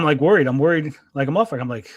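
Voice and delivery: normal talking voice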